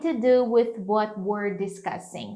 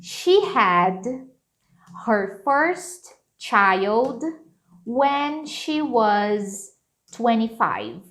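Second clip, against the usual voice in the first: slow, clear dictation pace; much slower than usual. Shown as a demonstration. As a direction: spell it out slowly for students. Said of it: "ficaria assim". (ditado bem devagar)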